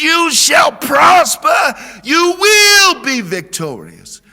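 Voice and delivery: rally the room with a rising shout; preaching at full force. Acted. Pastor urging the congregation to build bridges, full preaching cry.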